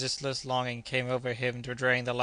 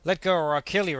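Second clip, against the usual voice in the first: raised, higher threatening cry; desperate threat; 4 semitones higher, 5 dB louder than usual. A narrator's reading. Kennedy wrestling Joe for the rifle, desperate threat. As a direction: threaten him as you struggle. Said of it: "repeated Kennedy".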